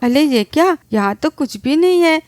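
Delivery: usual speaking voice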